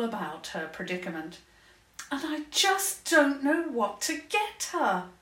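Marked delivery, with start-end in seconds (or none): 2.1-5.1 put-on high, worried girl's voice; a little girl at a loss; young princess voice.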